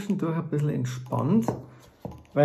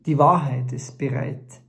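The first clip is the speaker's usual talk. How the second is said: measured spoken-word recital with long pauses between phrases; poem recitation; 3 semitones higher, 4 dB louder than usual. Acted. Author performing his text about truth and peace, poem recitation.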